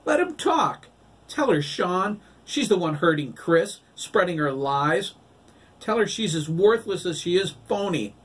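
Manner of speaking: put-on high, loud childish accusing shout. A narrator's reading. Boy yells accusations at the woman, high and loud. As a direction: hurl it at her across the church.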